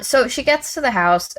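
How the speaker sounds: ordinary talk, nothing marked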